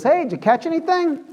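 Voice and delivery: high mock call across the water; calling out from shore. Stranger on the shore calls to fishermen, high mock yell. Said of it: "yells out to him".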